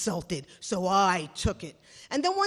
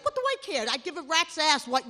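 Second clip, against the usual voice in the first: normal talking voice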